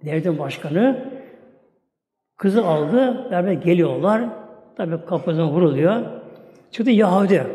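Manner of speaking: ordinary talk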